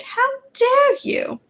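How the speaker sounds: teacher scolding a pupil, outraged; outraged McGonagall. Acted. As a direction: drag it out in scandalised fury.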